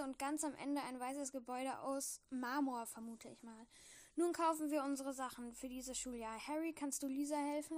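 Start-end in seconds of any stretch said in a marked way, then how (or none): none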